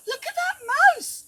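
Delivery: high, loud squealing call; excited chirp. Acted. Monkeys call out excitedly at the brave mouse. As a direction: shriek it out in amazement. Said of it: "chirped the monkeys".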